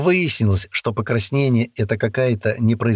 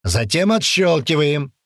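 drill instructor; 6 semitones higher than usual. A narrator's reading. Sergeant instructing.

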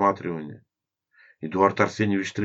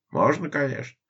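friendly laugh; 9 semitones higher than usual. Acted. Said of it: "ответил тот дружелюбным смехом".

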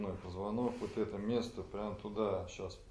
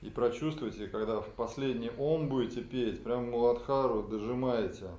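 emphatic instruction; 3 semitones higher, 8 dB louder than usual. Real, not acted. Instructor urging stronger push.

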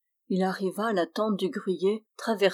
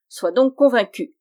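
louder, higher, impish; triumphant dwarf; 4 semitones higher, 7 dB louder than usual. Acted. Dwarf proving he is real, impishly loud. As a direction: say it with a mischievous pinch.

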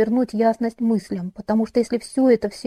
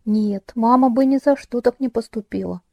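plain reading voice

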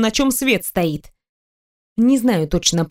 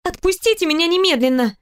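indignant demand; 8 semitones higher than usual. A narrator's reading. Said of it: "начала я стучать кулаками".

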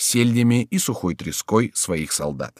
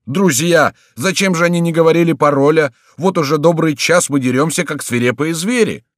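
loud, high protest; indignant outcry; 7 semitones higher, 5 dB louder than usual. A narrator's reading. Beaten townsfolk protesting to the duke, crying out indignantly. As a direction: protest loudly in exasperation. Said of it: "вскричали избитые усталые граждане".

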